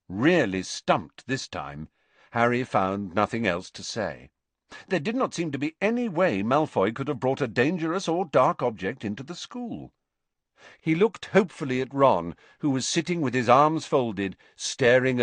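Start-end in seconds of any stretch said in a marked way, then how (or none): none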